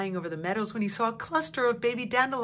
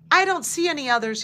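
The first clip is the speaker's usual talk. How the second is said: higher, quick and eager; eager report; 7 semitones higher, 6 dB louder, faster than usual. A narrator's reading. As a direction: rattle it off with excited energy. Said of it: "he reported".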